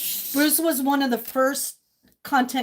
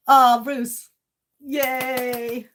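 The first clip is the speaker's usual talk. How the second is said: normal talking voice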